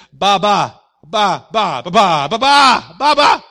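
put-on loud high babbling baby; toddler's loud babble. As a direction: babble like a baby reaching for the bottle.

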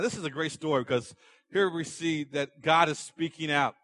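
normal talking voice

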